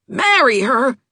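high, loud incredulous echo; exasperated cry. A narrator's reading. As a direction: throw the words back in exasperation. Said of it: "cried Jim in exasperation".

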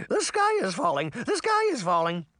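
very high, crying chicken voice; high-pitched squawk of alarm; panicked chicken. A narrator's reading. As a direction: cry the alarm like a frantic chicken. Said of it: "a chicken cried".

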